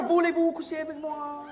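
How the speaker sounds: "Voulez-vous coucher avec moi?" said softly in French; French pickup line. Shown as a demonstration. Comic showing how nice the French sounds, soft French line. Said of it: "Like, you remember".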